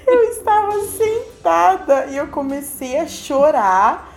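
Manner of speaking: laughing through the words, slowly. Real, not acted. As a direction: say it while cracking up. Laughing while telling. (rindo enquanto conta, devagar)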